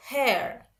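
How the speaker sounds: "hair" with an audible R at the end; R sound at the end. Shown as a demonstration. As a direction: say "hair" American-style, closing on R. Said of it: "ada R sound di belakangnya".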